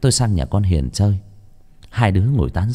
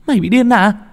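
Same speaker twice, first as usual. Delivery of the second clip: high, frightened shriek of disbelief; high panicked voice, pushing louder; Hien's shocked outcry; 4 dB louder than usual. A narrator's reading. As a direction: cry out in horror that the girl is long dead. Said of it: "Con Hiền nó đứng phắt lên".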